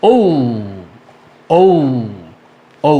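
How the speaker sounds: "ঔ" gliding from o into u; corrected letter name. Shown as a demonstration. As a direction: round "ঔ" off into oo. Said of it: "আমরা বলবো".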